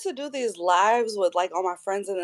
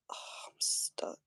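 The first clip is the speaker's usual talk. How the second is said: whispering; 16 dB quieter than usual. Real, not acted. Whispering while setting up.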